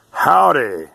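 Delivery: high, loud fake cowboy howdy; mock outsider howdy. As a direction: mimic the fake Texan greeting. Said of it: "And they'll go".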